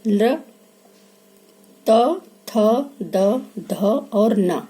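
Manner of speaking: ल, त, थ, द, ध और न spoken slowly one by one; dental letters sounded out. Shown as a demonstration. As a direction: sound out each दंत letter for the class. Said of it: "बोल के देखिए". (दंत वर्णों का उच्चारण करके दिखाना)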